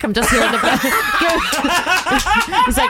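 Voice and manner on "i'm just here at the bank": half-hearted president impression, through laughter; Donald Trump. Player starting a bank robbery as the president, laughing half-hearted impression. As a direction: try the voice while giggling. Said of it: "what does Donald Trump".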